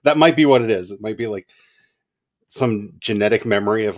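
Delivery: normal talking voice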